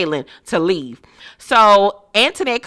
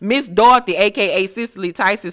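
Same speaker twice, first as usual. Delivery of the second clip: ordinary talk, nothing marked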